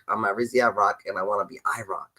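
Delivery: normal talking voice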